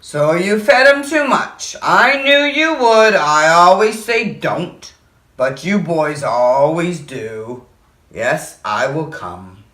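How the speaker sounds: slow, low sighing voice at the end; weary old man. Acted. Mister Carp scolds the boy, slow weary old voice. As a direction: sound like a tired old teacher. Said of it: "said Mister Carp".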